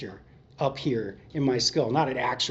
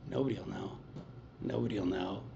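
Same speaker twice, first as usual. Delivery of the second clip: "Nobody'll know" said much softer and lower, a sly murmur; coaxing murmur; 6 semitones lower, 10 dB quieter than usual. Retold or imitated. Craving voice in his head tempting him, hushed. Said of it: "It's like".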